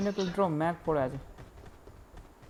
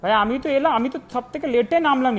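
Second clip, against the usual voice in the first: excited; 7 semitones higher, 8 dB louder than usual. Real, not acted. Frustrated gamer arguing with team.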